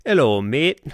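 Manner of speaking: botched Yorkshire-style British greeting; put-on British greeting accent. Shown as a demonstration. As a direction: try out a hammy Northern English accent. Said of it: "you sound like you smoked a lot of drugs".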